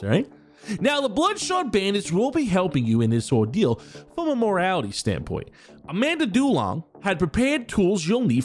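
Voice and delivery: high, upbeat boss character voice; eager corporate villain boss; Kemi Joe voice. Acted. Patreon character reads mission briefing.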